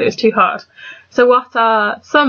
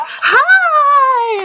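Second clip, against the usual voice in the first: much higher, bubbly mock American "Hi!"; mock enthusiastic American greeting; 3 dB louder than usual. Imitating American interviewees on Skype, hammed-up enthusiastic greeting. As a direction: gush the greeting like an overeager interviewee. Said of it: "Americans always sound so much more enthusiastic".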